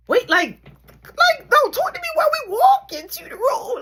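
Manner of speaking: loud, high begging; mock panicked plea. Son pleading loudly with mom on way to room.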